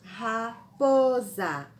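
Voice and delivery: Raposa stretched slow, za clearly sounded as z; slow dictation. Shown as a demonstration. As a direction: dictate Raposa slowly to the class. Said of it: "Quando nós falamos a última sílaba".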